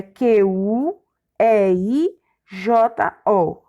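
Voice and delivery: slow, letter by letter; name each letter separately; spelled out. Shown as a demonstration. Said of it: "quando a gente pronuncia". (soletrando devagar, letra por letra)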